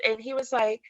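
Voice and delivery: ordinary talk, nothing marked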